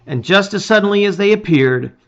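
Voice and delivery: plain reading voice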